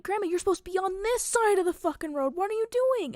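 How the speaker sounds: high, loud, exasperated outburst; acted-out frantic telling-off; retold panicked scolding. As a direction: yell at the driver in alarm, rising on the question.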